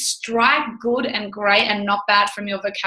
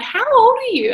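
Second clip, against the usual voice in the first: surprised; 11 semitones higher than usual. Retold or imitated.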